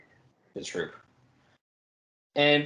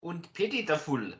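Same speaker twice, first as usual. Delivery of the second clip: mock German accent, lightly put on; a bad German take on the line; terrible German accent; 5 semitones higher, 4 dB quieter than usual. Host mocks a German strongman's catchphrase, bad German accent. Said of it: "my terrible accent".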